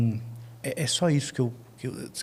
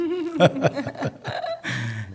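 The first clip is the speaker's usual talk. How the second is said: high laugh. Real, not acted. Laughs after rapture remark.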